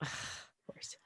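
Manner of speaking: barely audible whispered "of course"; fainter whispered repeat. Retold or imitated. Daughter repeating it to herself, fading whisper. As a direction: let it fade almost to nothing. Dad released after she left.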